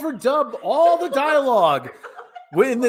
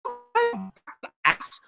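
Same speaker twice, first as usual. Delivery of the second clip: put-on Valley Girl accent; 3 semitones lower than usual. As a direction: stretch key words like a Valley Girl. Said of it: "have a Valley Girl accent".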